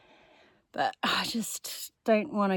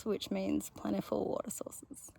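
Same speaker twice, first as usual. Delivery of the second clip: whispering; 10 dB quieter than usual. Real, not acted. Hopeful whisper.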